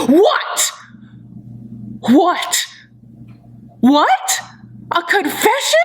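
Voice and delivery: high gasped questions, rising each time; mock shocked mom. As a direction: overdo the mother's disbelief, pausing between shocks. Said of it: "the mom's reaction's like".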